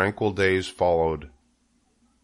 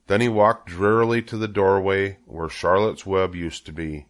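plain reading voice

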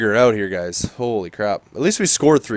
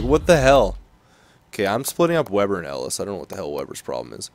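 ordinary talk, nothing marked

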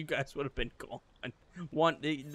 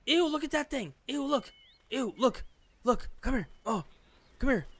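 much higher, grossed-out shouts; disgusted excitement; 9 semitones higher than usual. Real, not acted. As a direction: yelp at the gross bug to come see. Spots a gross creature.

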